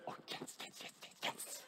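breathy unvoiced whisper of glee; gleeful whisper. Preacher whispering the crowd's gleeful yes. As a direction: whisper it like secret delight. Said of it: "We say".

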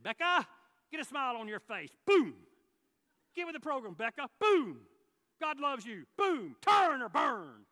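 hammed-up pushy street preacher, slowing hard on the last line; mock preacher shouting at a cashier; mock hellfire preaching. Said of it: "I could have had a lot of fun".

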